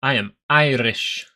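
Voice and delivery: lightly put-on, slow Irish accent; attempted Irish accent. Streamer telling viewers he is Irish, attempted Irish accent. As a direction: have a rough go at an Irish accent. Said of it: "I don't know how to do that accent very well".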